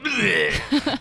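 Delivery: high comic retch; supposedly polite bleh. Acted. Knight thanks his rescuer, mock-polite retch. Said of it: "He thanks you with a polite".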